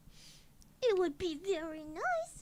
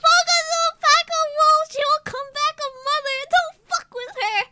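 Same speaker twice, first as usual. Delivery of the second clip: high, loud hype shout; hype slogan; 9 semitones higher, 15 dB louder, faster than usual. Shown as a demonstration. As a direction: belt it like a t-shirt slogan. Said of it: "Can we get that on a shirt".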